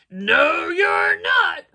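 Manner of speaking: loud, rising troll bellow. A narrator's reading. Troll contradicting the little goat, loud bellow. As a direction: shout it down as the troll. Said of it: "said the troll".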